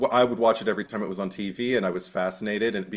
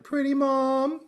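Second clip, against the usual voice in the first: teasing mom; slower than usual. Retold or imitated. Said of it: "she'd just be like".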